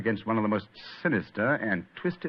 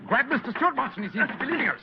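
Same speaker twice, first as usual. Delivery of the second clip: sudden high urgent command; urgent call; 13 semitones higher than usual. Acted. Holmes urges Watson to grab the fleeing man, high urgent command. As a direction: snap out an alarm.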